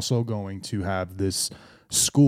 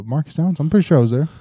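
surprised; 7 semitones higher, 7 dB louder, much faster than usual. Real, not acted. Protesting, surprised.

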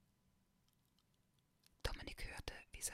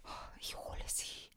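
grumbled. Acted. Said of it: "brummte Dominik".

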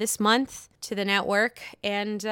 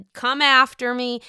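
ordinary talk

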